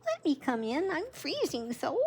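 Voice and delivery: high, small hare voice with a mild sob; grandma reading the freezing hare's plea, high and small; sobbing little hare. A narrator's reading. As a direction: play the tiny hare pleading to come in. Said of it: "He sobbed".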